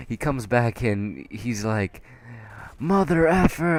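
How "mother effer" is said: high, loud mock outburst; angry customer. Big customer storming back over his burger, imitated angrily. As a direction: imitate an irate customer erupting.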